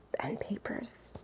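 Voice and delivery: soft unvoiced whisper, lingering on the words. Real, not acted. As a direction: breathe it out like a secret delight. Savouring a favourite book feature.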